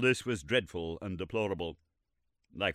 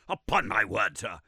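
stern and rigid; 5 dB louder than usual. Acted. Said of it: "said Lord John very stern and rigid".

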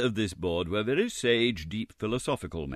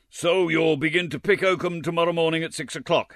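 higher, loud, surly announcement; surly gentleman; 9 semitones higher, 5 dB louder than usual. Acted. Surly gentleman assigning Oliver oakum picking, curt. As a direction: snap out the order. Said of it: "added the surly one".

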